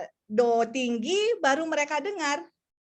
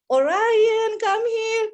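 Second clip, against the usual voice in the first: high, louder sing-song call; 9 semitones higher, 5 dB louder, much slower than usual. Pretend. Mami practises calling Orion to come, bright call. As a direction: call him from another room, brightly. Mother calls child from room.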